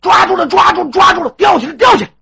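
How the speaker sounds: loud high clamour; excited shouting. Acted. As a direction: crowd yelling over the capture. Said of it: "这人就吵着起来了".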